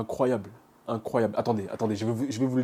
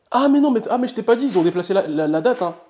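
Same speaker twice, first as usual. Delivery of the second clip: surprised protest; 9 semitones higher, 4 dB louder than usual. Real, not acted. Correcting mother about result time.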